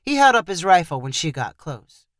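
plain reading voice

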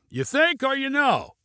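high, challenging shout; shouted reply. A narrator's reading. Pilot challenges passenger's warning, high shout. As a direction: shout back high, volume held back. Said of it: "Junie shouted in reply".

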